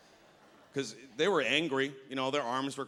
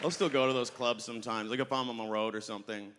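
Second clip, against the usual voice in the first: ordinary talk, nothing marked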